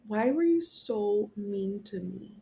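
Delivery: slow, drawn-out mock-tearful question; retold tearful plea. Patient retelling her plea to the nurse, tearful. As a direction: imitate yourself pleading through tears, lightly done. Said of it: "I start crying".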